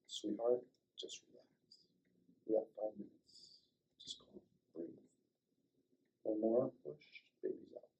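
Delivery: soft, hushed, reassuring words to a laboring wife; calm her down gently, almost breathing it; soothing reassurance. Retold or imitated.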